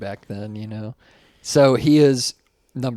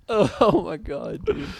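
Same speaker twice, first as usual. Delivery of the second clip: high laugh, words slowed by it; breathless with laughter; 10 semitones higher, slower than usual. Real, not acted. Laughing after the insult.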